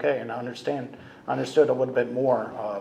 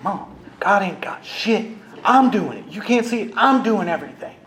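heated retort; 7 semitones higher, 4 dB louder than usual. Retold or imitated. Son snaps back at mom before slamming the door, heated. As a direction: throw it back at her, fed up.

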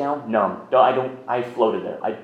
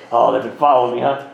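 put-on joking old-timer; 8 dB louder than usual. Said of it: "his father was like".

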